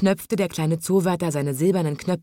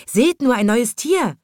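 higher, louder excited calling; excited crowd shout; 7 semitones higher, 5 dB louder than usual. A narrator's reading. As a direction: call out to the others in delight. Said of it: "schrien da die Leute".

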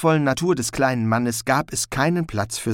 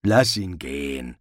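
much lower, slow menace; cold stranger; 9 semitones lower than usual. A narrator's reading. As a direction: say it flatly and coldly. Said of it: "sagte die Stimme".

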